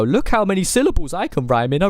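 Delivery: lampoon a show-off rapper; mocking boastful rapper. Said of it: "certain rappers 'cause they go".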